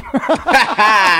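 a burst of laughter; laughing. Real, not acted. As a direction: laugh at yourself. Laughs at own story.

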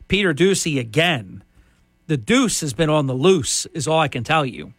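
ordinary talk, nothing marked

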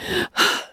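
soft, heavy sigh. A narrator's reading. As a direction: sigh in defeat. Anne sighing in dejection.

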